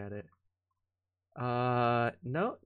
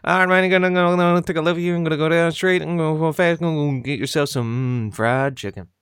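Boomhauer; 6 dB louder than usual. Acted. Streamer imagining drive-through instructions, as a mumbling cartoon impression. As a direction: run the words together in a loud mumble. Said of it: "I do a good Boomhauer".

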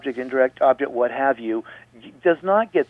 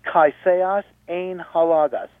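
slow Greek reading; 4 semitones higher, slower than usual. Shown as a demonstration. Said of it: "So, one more time".

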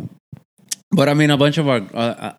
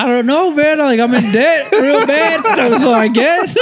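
laughing reply; 5 dB louder than usual. Retold or imitated. Answering the kid about his debt, laughing helplessly. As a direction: laugh through the sheepish answer. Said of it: "And I was like".

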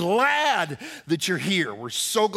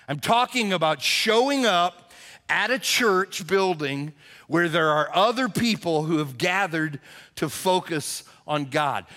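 usual speaking voice

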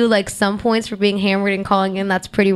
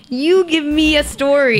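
exasperated; 5 semitones higher, slower than usual. Retold or imitated. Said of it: "I'm like".

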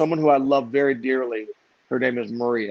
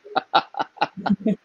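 laugh; 6 semitones higher than usual. Real, not acted. Laughs at his own story.

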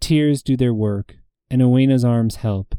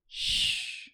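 a hushing breath, no voice; calm her with a gentle shush; soft shush; 14 dB quieter than usual. Acted. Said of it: "comes a voice".

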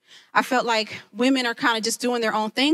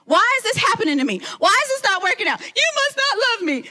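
mock temper tantrum; 10 semitones higher than usual. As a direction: act out your own tantrum, hammed-up. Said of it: "I have thrown temper tantrums".

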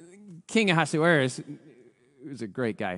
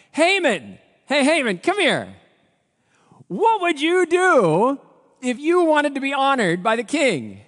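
calling out; 10 semitones higher, 7 dB louder, slower than usual. Acted. King calls Haman over.

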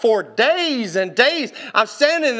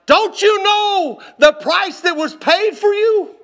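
mad scream; 10 semitones higher, 5 dB louder, slower than usual. Retold or imitated. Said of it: "I got so mad at her".

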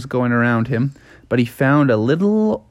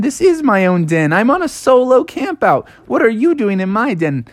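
higher-pitched protest; indignant bear; 8 semitones higher than usual. Acted. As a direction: argue that the den is yours. Bear argues over den.

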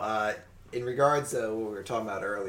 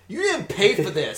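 accusing clerk; 8 semitones higher, 8 dB louder than usual. Retold or imitated. Acted clerk confronting shoplifter.

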